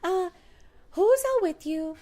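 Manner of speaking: high, lightly mimicked operator voice; a hint of a 911 dispatcher asking questions; lightly voiced dispatcher. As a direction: ask it like a calm 911 operator.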